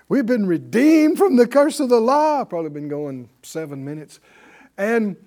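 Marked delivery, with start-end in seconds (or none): 0.1-2.4 raised, louder preaching cry; retold rallying shout, higher and loud; triumphant shout. Young preacher to a silent church, expecting shouts, triumphantly loud. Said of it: "everybody's supposed to shout about it".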